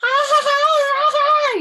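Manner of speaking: high, loud screaming cackle; scariest scream. Acted. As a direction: scream it out to spook grandma. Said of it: "I screamed".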